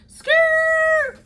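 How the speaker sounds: very high imitated squeal; tire screech. Imitating her car skidding up to his aunt's house. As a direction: screech like braking tires. Tires arriving at aunt house.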